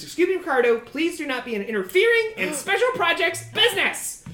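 high-pitched, prim little goblin. Acted. Goblin turns to scold his coworker, high prim voice. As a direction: rebuke a colleague in a high little voice. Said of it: "He says".

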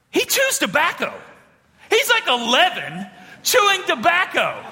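high, hammed-up outrage; scandalized child. Ten-year-old telling dad on cousin Jason, mock scandalized. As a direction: tattle in appalled disbelief, pitch jumping up.

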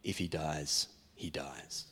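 put-on low, soft Drago-style Russian accent; deadpan Russian boxer voice, quiet and heavy; mock Rocky IV Russian accent, dropped low. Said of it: "I may have said in a Russian accent".

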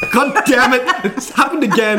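mock exasperation, laughing. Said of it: "And I'd be like".

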